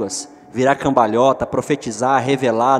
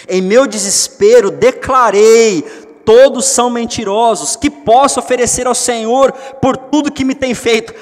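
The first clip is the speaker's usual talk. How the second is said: louder, rising psalm reading; psalm proclamation; 7 semitones higher, 6 dB louder than usual. Acted. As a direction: declare the psalm with rising force. (leitura do salmo, crescendo em força)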